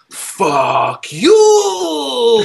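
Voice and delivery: high, loud, long-drawn mimicry of a classmate; hammed-up imitation. Mimicking the kid who popped up in the library, hammed-up. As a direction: stretch it out into a loud, hammy high howl. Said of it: "kind of hamming it up".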